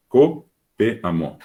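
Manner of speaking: Copeamo spelled out slowly, syllable by syllable; slow Guaraní demo. Shown as a demonstration. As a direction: drag out Copeamo clearly for the class. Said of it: "tener un adjetivo determinativo como copeamo".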